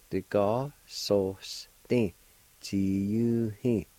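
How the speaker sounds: whole word drawn out slowly; slow careful pronunciation. Shown as a demonstration. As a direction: say it slowly for the class. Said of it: "it's pronounced like this".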